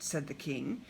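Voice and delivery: ordinary delivery, nothing marked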